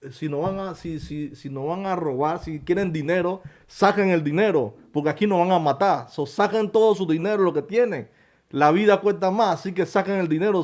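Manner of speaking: high, loud urgent commands to the robbers; shouting orders to take the money, not kill; retold commanding shout. (gritos ordenando a los ladrones que saquen el dinero)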